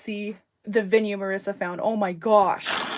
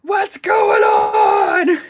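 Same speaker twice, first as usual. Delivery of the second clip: pretend yell; 10 dB louder, much slower than usual. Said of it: "right now is when I would be like".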